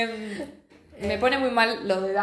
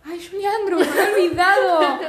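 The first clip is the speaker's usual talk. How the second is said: excited laughing; 9 semitones higher, 7 dB louder than usual. Real, not acted. Delighted outburst about the narrator.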